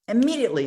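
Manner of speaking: "Immediately" punched high and loud, falling at the end; stressed immediately. Real, not acted. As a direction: hit "Immediately" hard and high. Echoing the word to stress it.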